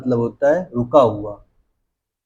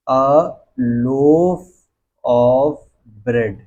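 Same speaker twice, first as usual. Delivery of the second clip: "a loaf of bread" very slowly, a pause after each word; word-by-word dictation; much slower than usual. Shown as a demonstration. Teacher dictates a loaf of bread to students, word by word.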